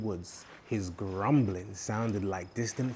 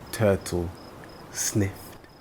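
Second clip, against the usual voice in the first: usual speaking voice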